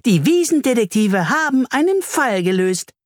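high, triumphant little voice; celebratory high bee voice; jubilant bee. A narrator's reading. Bee cheers the solved case.

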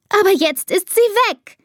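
very high, upset outcry; voice tipping over. A narrator's reading. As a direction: let the voice jump up in panic. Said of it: "Elisas Stimme kippt".

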